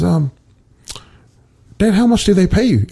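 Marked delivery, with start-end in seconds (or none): 1.8-2.9 higher, lightly voiced little girl. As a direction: lightly imitate a child asking her dad. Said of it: "and she says".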